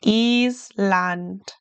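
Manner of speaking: Island with ee, Eesland; Island pronounced the German way. Shown as a demonstration. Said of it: "German I sounds like an E".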